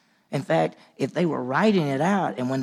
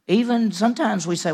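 usual speaking voice

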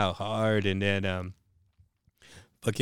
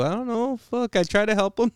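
high-pitched, helpless retold excuse; defensive protest; 11 semitones higher than usual. Son excuses himself to angry mom, imitated high defensive protest.

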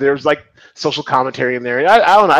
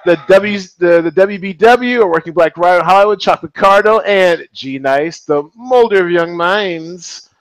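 announcer voice; 2 semitones higher than usual. Acted. Introducing guests, showman style.

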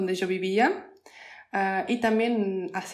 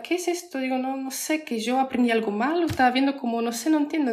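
higher-pitched mock confusion; retold bewilderment; 5 semitones higher than usual. Recalling her beginner days to listeners, retold bewilderment. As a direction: act out a baffled learner, pitched up.

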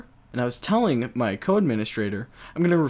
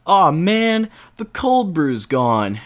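loud, high, slow letdown; hammed-up groan of disappointment; disappointed whine; 7 semitones higher, 7 dB louder, slower than usual. Acted coworker disappointment.

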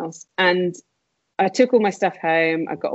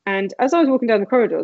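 usual speaking voice

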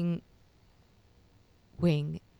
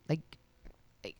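normal talking voice

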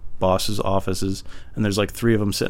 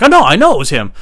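loud, higher-pitched accusation; heated insistence; 8 semitones higher, 9 dB louder, faster than usual. Retold or imitated. Employee telling bosses who reported him, heated insistence. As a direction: cut in, loud and certain.

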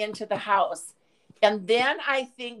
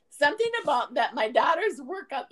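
normal talking voice

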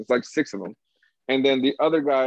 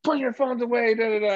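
much higher mocking voice; imitating the girl employee; 10 semitones higher than usual. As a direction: pitch it up like the young employee. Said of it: "she's telling".

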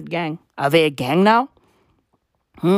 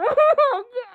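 high laugh. Real, not acted. Laughing at own joke.

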